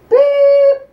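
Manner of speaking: high loud censor beep; robot beep. Acted. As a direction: bleep out the swear.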